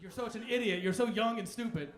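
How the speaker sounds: fifty-year-old mocking youth; scornful older person. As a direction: talk down at a kid. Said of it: "It's like".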